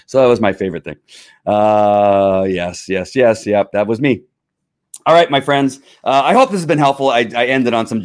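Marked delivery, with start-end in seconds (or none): none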